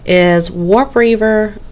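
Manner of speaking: slow Southern drawl on the name; drawn-out Southern accent on a game name. Shown as a demonstration. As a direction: say Warp Reaver Dushar like a Southerner, taking your time. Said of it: "I say it with a southern accent".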